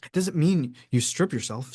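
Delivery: ordinary delivery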